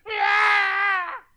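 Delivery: sudden high, loud wailing cry; imitated wail. Preacher imitates a hired funeral wailer, loud wailing cry. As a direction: let out a mourner's wail. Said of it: "like, professional wailers".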